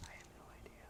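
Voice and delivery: hushed voiceless whisper. Real, not acted. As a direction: whisper back with no voice. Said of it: "Why are you whispering?".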